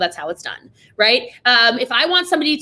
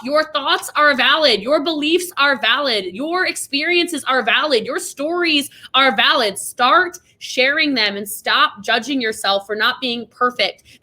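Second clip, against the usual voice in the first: usual speaking voice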